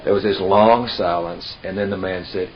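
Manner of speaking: normal talking voice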